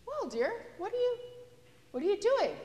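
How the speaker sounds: put-on high, bemused voice asking a child; mock-baffled dad questioning his daughter; puzzled father. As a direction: sound baffled by what the kid is doing. Father asks girl in manure.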